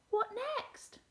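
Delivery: high-pitched eager question; excited cry at normal volume; cheerful, excited cry. A narrator's reading. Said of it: "cried the now cheerful and excited pencil".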